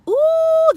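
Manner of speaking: a high loud excited ooh. Real, not acted. Teller squeals before the drama, loud ooh. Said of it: "that set her off".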